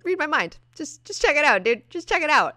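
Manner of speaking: pushy Moira. Retold or imitated. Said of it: "She's like".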